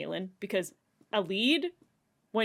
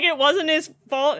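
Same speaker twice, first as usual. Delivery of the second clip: defending; 8 semitones higher, 10 dB louder than usual. Retold or imitated. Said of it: "Elide's like".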